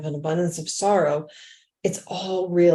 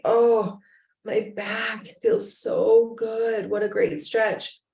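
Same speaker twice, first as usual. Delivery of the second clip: higher, drawn-out imitated delight; blissful relief; 5 semitones higher, slower than usual. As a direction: savor the stretch as a grateful resident would. Imitates resident relieved by stretch.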